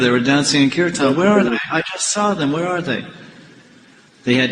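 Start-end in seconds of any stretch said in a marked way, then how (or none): 1.2-3.0 higher-pitched, puzzled searching; bewildered. Retold or imitated. Puzzled devotee looking for the deities who left the altar, bewildered. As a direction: act out mild alarm at a disappearance.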